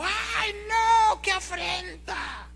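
high, slow, hammed-up wail of a shocked mother; mother's mock outcry. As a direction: cry out to heaven like the scandalised mother. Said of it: "la señora pega el grito en el cielo". (grito exagerado de madre escandalizada, agudo y lento)

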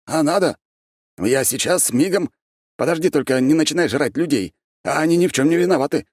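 high-pitched, eager character voice; comically anxious, jumpy friend; flustered friend. A narrator's reading. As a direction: hurry to placate, pitched well up.

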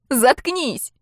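high, sharp retort; exasperated high-pitched order; angry outburst. A narrator's reading. She cuts him off angrily.

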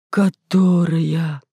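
very slow moaned word; long suffering moan; groaning. A narrator's reading. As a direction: groan КОТОРАЯ out long and drawn. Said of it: "простонал тот же голос".

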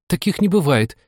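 high-pitched cross objection. A narrator's reading. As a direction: contradict crossly in a girlish lift. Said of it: "рассердилась была Аня".

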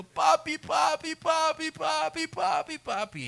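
put-on high, repeated child's wail; high tearful calling, fading at the end; child crying out. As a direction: cry out for daddy like a hurt little girl. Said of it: "que empiece a clamarme llorando".

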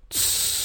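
soft, long "tsss"; hissed shush. A narrator's reading. Fox silences the bear, hissing a shush. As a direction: hush him with a sharp hiss. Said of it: "опять прошипела лисица".

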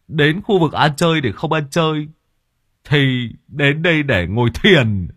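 put-on loud, high slurred drunk; drunken slur. A narrator's reading. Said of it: "giọng lè nhè".

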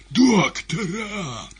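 high, long-drawn wail; desperate cry. A narrator's reading. Severed head crying to the whole theatre, desperate wail. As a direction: scream desperately for help. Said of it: "голова эта отчаянно крикнула".

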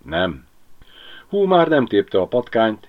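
ordinary delivery

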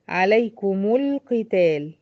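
عليكم القتال with mim voweled as alaikumu, slow; عليكم القتال joined with a damma on the mim; tajweed demo. Shown as a demonstration. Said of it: "طب زي ايه؟".

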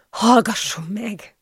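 slow, hushed frightened plea; frightened whisper. A narrator's reading. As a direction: plead fearfully, drawing it out. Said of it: "suttogtam már ijedten". (ijedt, suttogó könyörgés)

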